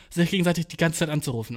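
usual speaking voice

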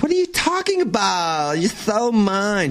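high put-on voice, stretched ending; acted retort. Retelling a flirty protest at the bar, high mock retort. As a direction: push it up high and draw out the last words. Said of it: "And I'm like".

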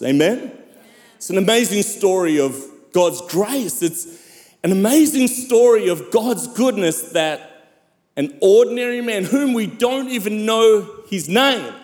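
emphatic sermon delivery with long dramatic pauses; preaching. Acted. Pastor telling the church of a healing miracle, preaching. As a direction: preach it in swelling, weighted phrases.

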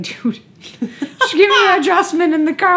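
high, loud laughing plea. Retold or imitated. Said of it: "I'd be like".

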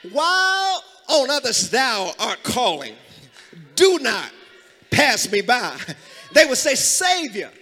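loud, drawn-out hymn recitation; fervent preaching. Real, not acted. Preacher reciting old church hymns to congregation, fervent. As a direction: cry the hymn lines out in bursts, long pauses between.

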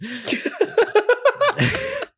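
long high-pitched cackle, losing composure; squealing fit of laughter; high helpless laugh. Real, not acted. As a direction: burst out laughing uncontrollably. Losing it at the picture.

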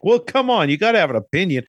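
high, loud coaxing; pushy Vincent. Retold or imitated. Vincent pressing Marvin in the car, high and loud.

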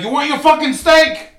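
loud, high, hot-tempered tough guy; furious high-pitched acted bark; Jake LaMotta. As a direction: yell back in a movie quarrel. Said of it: "Jake LaMotta".

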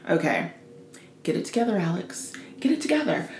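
muttered under her breath to herself; talking herself down, low and soft; quiet self pep talk. Retold or imitated. Alone at the desk, coaching herself quietly.

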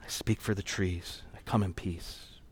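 low, very soft, slowed recitation; imitated hushed rehearsing. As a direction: imitate a child practising lines in secret. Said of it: "like she was rehearsing".

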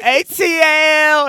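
high, loud, slow "A T L!" hype shout. Retold or imitated. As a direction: call out your city with pride. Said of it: "I'm like".

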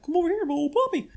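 high, fast, otherworldly demon voice. Retold or imitated. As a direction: squeal it like a gleeful little demon coaxing a pet. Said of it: "in a very demon-like way".

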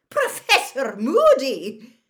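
high, loud, drawn-out exclamation; shocked. A narrator's reading. McGonagall comes upon Moody bouncing a ferret, shocked. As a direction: cry out the name in scandalised shock. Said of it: "said a shocked voice".

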